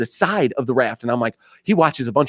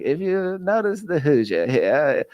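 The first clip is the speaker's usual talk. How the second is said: David Attenborough; 3 semitones higher than usual. Acted. Explaining a river Hoosier to friends, mock nature-documentary voice. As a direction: narrate like a wildlife film, savouring each observation. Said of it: "I went into David Attenborough mode".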